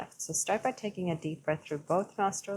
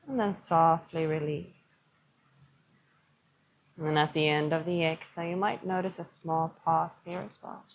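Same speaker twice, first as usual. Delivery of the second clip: calm yoga teacher easing the class into stillness; guided meditation. Acted. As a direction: lead them gently through the breath. Guides a seated breathing practice.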